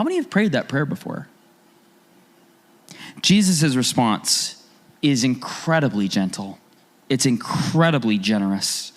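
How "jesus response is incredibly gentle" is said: usual speaking voice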